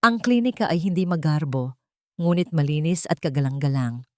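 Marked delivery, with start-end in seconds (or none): none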